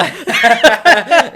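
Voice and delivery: big loud laugh, high and bright; loud burst of laughter. Real, not acted. Laughing hard after the host's joke about Scousers. Host jokes we can't get rid of you.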